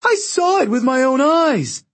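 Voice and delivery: high-pitched, insistent shriek. A narrator's reading. Octavian swears he saw Leo fire, shrieking. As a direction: shriek it as indignant testimony. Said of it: "Octavian shrieked".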